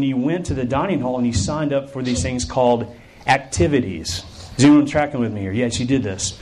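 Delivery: ordinary talk, nothing marked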